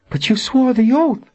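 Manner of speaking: high, disbelieving objection; shocked protest. A narrator's reading. Verna reminds Amelia of the sworn oath, shocked protest.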